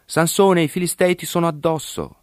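calling out high, sharp warning; urgent high shout of alarm; high alarmed cry. A narrator's reading. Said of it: "Essa gli gridò".

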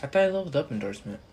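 usual speaking voice

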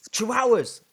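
loud, high burst of relief at remembering; excited exclamation. Real, not acted. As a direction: shout the word out, delighted it finally came. Finally remembers the word, exclaims.

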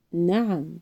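affirmative. A narrator's reading. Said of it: "أجاب بتأكيد".

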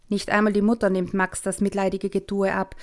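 ordinary delivery

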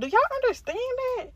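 shrill-high, fast, pleading at the lawmakers; exasperated squeal. Real, not acted. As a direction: ask it in disbelief.

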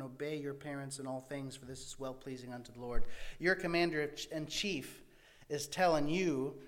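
normal talking voice

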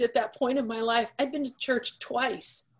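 normal talking voice

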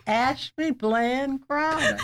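drawn-out name through a laugh; amused reveal, laughter breaking in. Retold or imitated. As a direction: retell it slowly, tickled by the answer. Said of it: "And I started laughing".

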